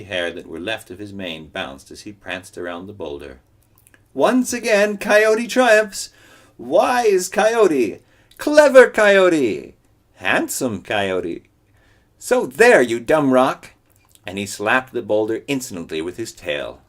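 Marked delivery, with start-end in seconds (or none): 4.1-13.7 high, loud, slow bragging; high loud showing-off; boastful crowing. Acted. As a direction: parade your triumph. Coyote brags and taunts rock.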